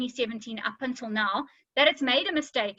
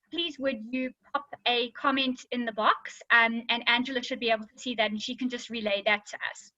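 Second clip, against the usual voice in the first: ordinary talk, nothing marked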